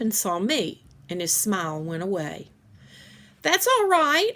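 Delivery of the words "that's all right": high, loud call across the yard; a friendly call over the fence. A narrator's reading. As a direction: call out to the boy. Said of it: "I called".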